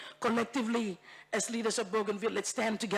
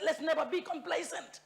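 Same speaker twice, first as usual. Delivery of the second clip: ordinary talk, nothing marked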